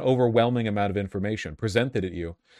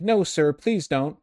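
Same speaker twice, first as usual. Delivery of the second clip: higher, simpering plea to a boss; mock groveling aide, high and pleading; pleading servile stewards; 7 semitones higher than usual. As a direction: beg the dictator meekly and politely. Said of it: "corporatist stewards are like".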